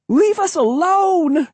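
very high, drawn-out plea; desperate girl's high wail; crying out. A narrator's reading. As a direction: plead in a shrill, frightened cry. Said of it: "Cassie cried".

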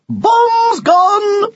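very high, loud drawn-out call; gleeful shout. Acted. Boy dropping boxes out of the window, shouting. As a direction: holler it high and long.

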